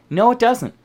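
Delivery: ordinary delivery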